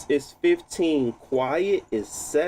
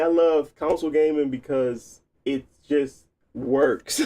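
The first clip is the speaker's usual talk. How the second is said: ordinary delivery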